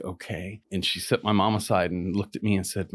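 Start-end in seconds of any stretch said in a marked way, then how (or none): 0.0-0.6 serious. Retold or imitated. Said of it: "real reverence and real fucking serious".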